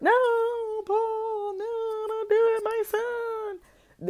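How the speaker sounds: high, hammed-up mother's plea; mock wailing mother. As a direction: wail like a desperate mom, overdone. Mother pleading, held back.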